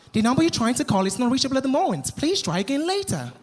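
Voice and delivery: quick, even recorded-operator voice; imitated call-failed announcement; automated phone message. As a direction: recite like a network recording.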